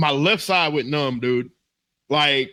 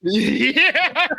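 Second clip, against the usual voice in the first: high, breaking into real laughter; laughing agreement; 9 semitones higher than usual. Real, not acted. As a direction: crack up while agreeing. Cracks up at host's joke.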